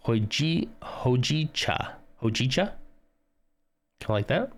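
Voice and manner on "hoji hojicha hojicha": Hojicha said slowly, the J and the cha both clear; careful pronunciation. Shown as a demonstration. Reading the correct tea name off, Hojicha pronounced carefully. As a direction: sound out Hojicha syllable by syllable. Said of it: "You pronounce both the J and the cha".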